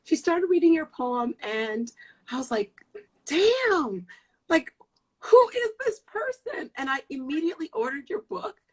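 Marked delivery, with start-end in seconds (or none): 3.2-6.7 high, astonished re-enactment; amazed reaction. Retold or imitated. Retelling hearing the poem first time, mock-stunned. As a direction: act out being floored, pitch jumping up.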